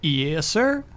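put-on Kansas accent. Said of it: "We're just working on accents".

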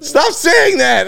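loud, high, drawn-out plea; exasperated mock yell. Son begging mom to stop repeating it, exasperated yell. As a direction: stretch it into an embarrassed wail.

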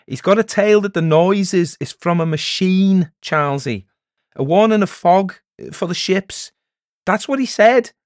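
high, loud, excited explaining; agitated villagers. Acted. Villagers explaining the peddler's tale to Charlesy, agitated. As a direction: blurt out the news to the elder.

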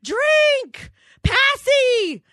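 put-on high shouted toddler demand. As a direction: bark it like a bossy two-year-old. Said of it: "He was like".

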